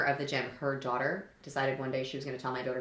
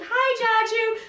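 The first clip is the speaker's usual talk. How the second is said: put-on high, loud kid's holler; excited child calling; 11 dB louder, much slower than usual. Waving girl greets grandfather from the field, excited high call. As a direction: imitate a child yelling hello.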